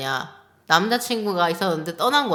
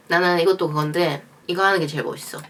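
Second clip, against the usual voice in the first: usual speaking voice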